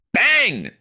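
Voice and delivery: explosive high shout; loud, startling sound-effect word; sudden bang. A narrator's reading. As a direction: shout it like a blow on the door. Narrator voices the door hit.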